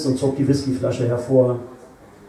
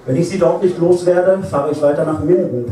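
provoking; 5 semitones higher, 5 dB louder than usual. A narrator's reading. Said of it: "provozierte sie mich".

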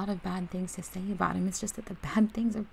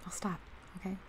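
soft, apologetic surrender; quietly conciliatory; backing down; 7 dB quieter than usual. Acted. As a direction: drop the volume and give in gently. Backing down after upsetting friend.

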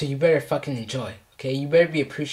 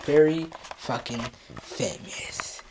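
slow menacing whisper; 4 dB quieter, slower than usual. Acted. Menacing aside.

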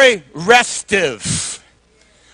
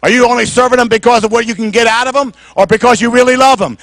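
ordinary talk, nothing marked

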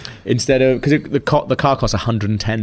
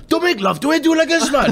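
put-on Welsh accent. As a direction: shout it like a Welsh farmer. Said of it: "I love that accent you did there".

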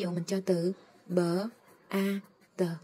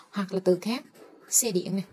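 ordinary talk, nothing marked

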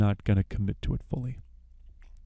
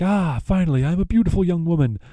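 higher and louder, hammed-up triumph; delighted witch; 8 semitones higher, 4 dB louder than usual. The transformed witch admires herself, mock delighted. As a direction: gush it like a vain witch freed from the curse. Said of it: "she's like".

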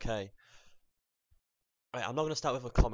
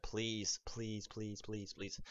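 begging; much slower than usual. Real, not acted. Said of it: "I'm begging right now".

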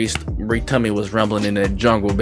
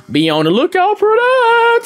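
hyped shout-out; 5 dB louder, slower than usual. Real, not acted. Loud sung-out plug for upcoming video.

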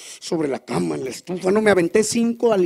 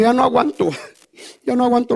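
raised pitch, whiny pleading; high pleading wife; 7 semitones higher than usual. Retold or imitated. Wife begging her husband, high and whiny. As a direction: beg like you can't take the pain.

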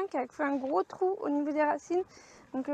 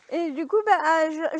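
ordinary delivery

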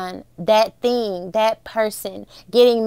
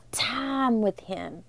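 whispering; 10 dB quieter, much slower than usual. Real, not acted. Sermon point, breathy aside.

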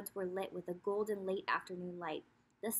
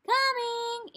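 high, loud shout out the window; girl shouting back to her mother from the treehouse; 9 dB louder than usual. A narrator's reading. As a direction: yell it down to mom. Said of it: "Annie shouted again".

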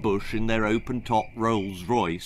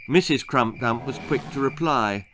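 plain reading voice

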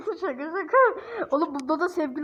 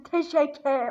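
ordinary delivery

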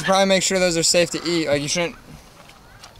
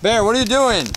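exasperated call; 7 semitones higher, 4 dB louder than usual. Acted. Calling to erratic man.

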